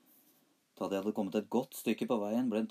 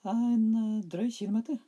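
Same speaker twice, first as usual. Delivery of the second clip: put-on very high, loud little boy; 13 semitones higher, 8 dB louder than usual. A narrator's reading. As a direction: chirp it like a small boy. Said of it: "svarte Smørbukk".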